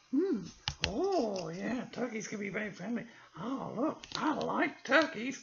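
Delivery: the puppet copies the reader. Acted. Puppet repeats the narration.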